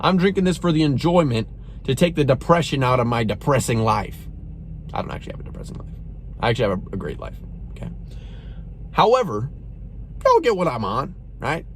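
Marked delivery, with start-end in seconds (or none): 4.9-7.9 hushed, breathy confession under the breath; whispered aside. Real, not acted. Whispering a correction to viewers about his own life. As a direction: let it slip out as a quick whisper.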